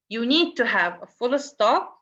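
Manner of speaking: ordinary delivery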